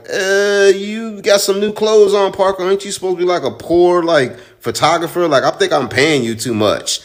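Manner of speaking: a grumpy editor ribbing his photographer, high and sneering; mocking boss voice. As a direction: pitch it way up like a needling boss. Boss voiced mocking Parker's clothes.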